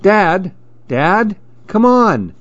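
put-on high, pleading kid calling Dad; high-pitched, insistent call; impatient little boy. A narrator's reading. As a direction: nag a distracted parent in a child's voice. Boy urges dad off phone.

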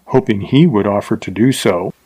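ordinary delivery

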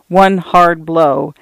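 usual speaking voice

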